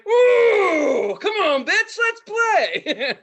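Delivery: high, loud whoop into a challenge; hyped-up battle cry. Retold or imitated. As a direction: holler a taunting dare, hammed up. Acted eager reply to the heckler.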